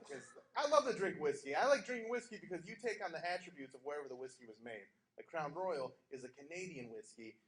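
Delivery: normal talking voice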